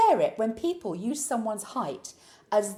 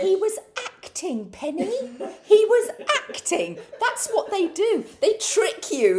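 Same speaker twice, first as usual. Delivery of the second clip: high, loud, slow insistence with laughter; hammed-up exasperation; mock exasperation; 10 semitones higher, 5 dB louder than usual. Real, not acted. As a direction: spell out the obvious.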